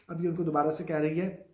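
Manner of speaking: usual speaking voice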